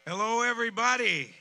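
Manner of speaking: loud, high greeting shouted to the crowd; calling out. Real, not acted. Host greets the crowd from the stage, loud and high. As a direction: throw the hello out over the whole crowd.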